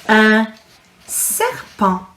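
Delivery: un serpent, said the French way with a silent T; French pronunciation. Shown as a demonstration. Teacher modelling un serpent for children to repeat. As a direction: say serpent with no T at the end, nasal French ending. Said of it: "The T is silent again".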